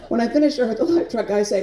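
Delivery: usual speaking voice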